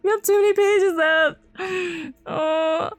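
high, loud wailing voice; overwhelmed reader wailing in distress; tearful outburst. Real, not acted. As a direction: whine it out, close to tears. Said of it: "I wanna cry".

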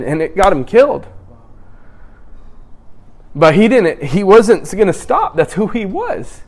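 normal talking voice

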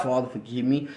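hushed sidelong plea under the breath; soft penitent mutter, as if excusing himself; quiet aside prayer. Acted. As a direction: drop the voice low and soft for a quick muttered prayer. Muttered mock prayer after saying names.

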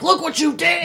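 high mock yell at a spider; mock screaming. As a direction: scream at the pet, hammed-up. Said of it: "a person screaming at a spider".

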